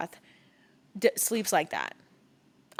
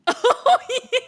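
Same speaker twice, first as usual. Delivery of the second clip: much higher and louder, laughing; laughing shriek; 10 dB louder than usual. Real, not acted. Bursts out laughing at the joke.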